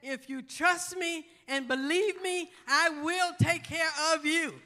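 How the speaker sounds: loud, rising preacher's cry with a held tune; full-force preaching. Acted. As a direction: preach it out to the congregation, building to a shout. Preaching climax, praise shouts.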